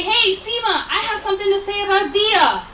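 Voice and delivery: a light, high call across the room; calling out a name. Shown as a demonstration. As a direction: call her name out brightly to get her attention. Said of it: "call out my name".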